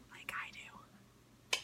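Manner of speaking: very soft, drawn-out whisper; whispered aside. Real, not acted. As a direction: whisper it like a little confession. Whispered confession of loving bagels.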